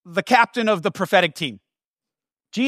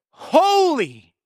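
higher, ringing angelic cry of Holy; passionate declaration; 7 semitones higher than usual. Acted. Angel crying out before the throne, in a ringing declaration. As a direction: proclaim it like an awestruck angel. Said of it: "they declare again with passion".

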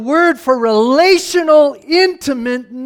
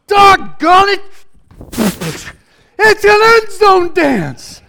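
much higher, louder, slow end zone whoop; celebrating; 8 semitones higher, 5 dB louder, slower than usual. Acted. God celebrating over his child like at a touchdown, wild excitement. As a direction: celebrate like a fan after a touchdown.

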